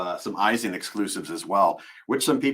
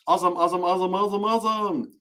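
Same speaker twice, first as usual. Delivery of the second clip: singsong chatter; 9 semitones higher, faster than usual. Real, not acted. Comic run of one repeated word.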